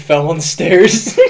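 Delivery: ordinary talk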